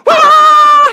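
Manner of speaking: high, loud shriek of joy; scream. Acted. Wife seeing the bank account, loud high scream. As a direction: scream the wife's shock out. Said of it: "She starts screaming".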